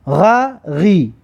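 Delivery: غ، غی drawn out slowly, gha then ghi; slow letter drill. Shown as a demonstration. Teacher drilling letters for pupils, slow. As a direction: sound out غ، غی slowly. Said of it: "و بعدش".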